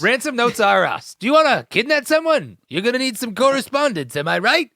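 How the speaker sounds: high-pitched, hammed-up commercial pitch; playful salesman spiel, lifted and punchy; mock ad pitchman. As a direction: sell it like a cheesy company advert.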